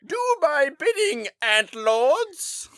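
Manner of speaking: very high hammy villain command; booming echoing villain monologue. Pretend. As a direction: command the minions theatrically. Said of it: "a villain in a movie".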